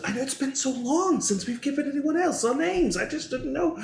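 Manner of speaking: high-pitched, apologetic character voice; flustered Skyhawk. Acted. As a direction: plead your excuse in a very high voice. Skyhawk makes excuses to Grumpy.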